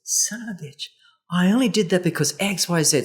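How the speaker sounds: high mock-indignant burst, a partner caught out; defensive outburst. Partner's defensive reply to criticism, mock outrage. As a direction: snap back in mock outrage at the complaint. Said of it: "And then he goes".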